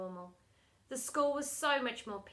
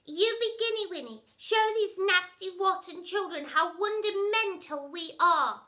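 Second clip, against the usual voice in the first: put-on higher, louder sugary baby talk; higher soppy child voice; babyish son; 7 semitones higher, 6 dB louder than usual. A narrator's reading. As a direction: gush like a spoilt cooing child.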